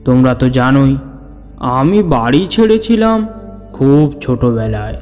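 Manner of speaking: plain reading voice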